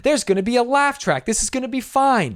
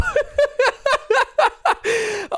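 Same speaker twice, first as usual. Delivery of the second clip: long, high-pitched giggling fit; high helpless laugh. Real, not acted. Storyteller cracking up at his own story, high real laughter. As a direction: crack up in high rapid bursts.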